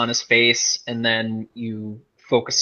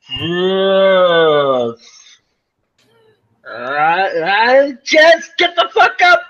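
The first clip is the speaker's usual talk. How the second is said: much higher and louder, dragged-out shouted command; furious yell; 6 semitones higher, 5 dB louder, much slower than usual. Acted. Yelling at the dazed woman lying in the street, furious. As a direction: scream her back to her senses. Character yells at dazed friend.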